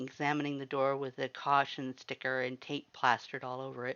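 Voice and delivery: ordinary delivery, nothing marked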